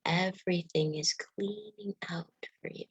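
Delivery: whispering. Acted. Guided body meditation.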